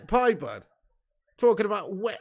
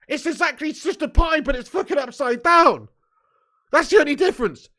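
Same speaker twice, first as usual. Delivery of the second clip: loud rant; 7 semitones higher, 9 dB louder than usual. Real, not acted. Ranting at viewers about upside-down pies.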